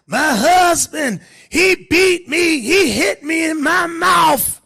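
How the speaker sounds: high, loud, slow wailing wife; mock wife complaint. Preacher playing the wife crying to the church, wailing high and loud. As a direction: ham up the wronged wife's cry.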